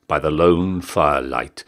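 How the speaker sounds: plain reading voice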